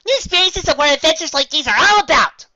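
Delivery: high, loud bubbly voice; Pinkie cheerful. Acted. Pinkie reassuring Galahad, high and loud. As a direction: bounce it out brightly.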